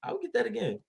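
high excited gush; delighted. Retold or imitated. Praising the wine he loved, high and gushing. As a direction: squeal how good it tasted.

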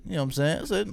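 usual speaking voice

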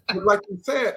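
laughing through the words; amused laugh. Real, not acted. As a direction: laugh as you start talking. Laughing at rich man's free food.

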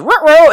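high, loud choking gurgle; strangled monster croak; kobold death gurgle. Acted. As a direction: gurgle like a creature hit in the neck.

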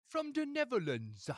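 high, slow mock Cockney accent; hammed-up Cockney accent. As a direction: put on a cheeky Cockney accent to say where the band is from. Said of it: "Is that how they talk in the Netherlands?".

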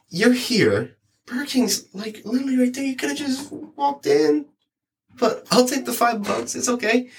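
high, sarcastic disbelief; high incredulous "you could've walked" voice; exasperated sarcasm. Retold or imitated. Exasperated retold inner thought.

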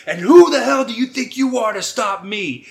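high, loud mock villain roar; villain's loud challenge. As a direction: bellow it at the hero, hammed-up. Acts villain challenging Joe.

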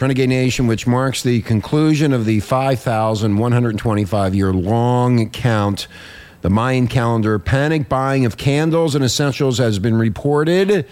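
measured radio news delivery; news read. Acted. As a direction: read the report aloud, stretching key phrases slowly. Host reads a news report.

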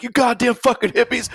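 high-pitched mock tirade; angry dressing-down. Host imitating Rick berating hippies in his driveway, high mock-angry rant. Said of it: "dressing down of the hippies".

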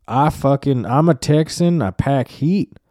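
high, loud, proud Texan lady; mock Texan woman. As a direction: puff up like a gun-proud Texan. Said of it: "faction of women that were like".

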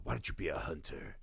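low, soft mock menace; a hint of a menacing hunter. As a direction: imitate a villain hushed. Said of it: "And he's like".

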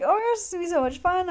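high, fast excited rush; excited gush. Retold or imitated. Fan telling idol about the Mexico concert, thrilled. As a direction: rattle it off thrilled. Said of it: "I was telling him how excited I was".

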